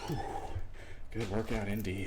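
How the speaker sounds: out of breath, still panting; catching breath after the set. Real, not acted. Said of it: "Apologize for my panting".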